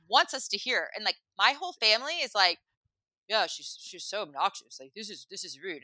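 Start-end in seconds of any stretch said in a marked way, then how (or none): 3.3-5.8 muttered disapproval. Retold or imitated. Family quietly grumbling about the woman.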